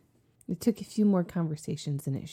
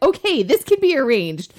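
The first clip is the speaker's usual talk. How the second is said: high, loud, laughing eagerness; eager coworkers; 8 semitones higher, 10 dB louder than usual. Retold or imitated. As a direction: egg her on through laughter.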